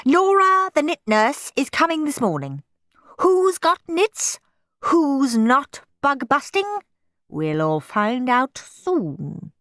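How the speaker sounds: high, slow menacing teacher; stern teacher. A narrator's reading. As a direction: warn them ominously. Teacher threatening the class.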